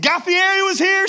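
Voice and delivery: high, loud thrilled question; excited. Retold or imitated. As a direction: burst out like a starstruck fan.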